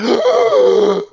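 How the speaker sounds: sharp high startled gasp; shocked gasp. Retold or imitated. Caught by housemate mid-rant, mortified gasp. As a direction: gasp like you've been caught. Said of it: "And I was like".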